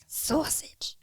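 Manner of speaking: exaggerated Italian sausage; Italian accent. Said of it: "more intense Italian".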